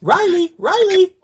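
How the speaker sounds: very high mock pet call; high sing-song call for Riley. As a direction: coax him out like a dog, high and loud. Buffy calling, whistling for Riley.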